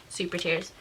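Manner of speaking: ordinary delivery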